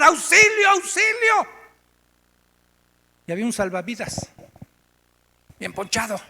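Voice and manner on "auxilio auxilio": high, shouted plea; drowning man yelling for help; desperate cry for help. Retold or imitated. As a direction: scream for help while drowning. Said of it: "empecé en mi desesperación a gritar".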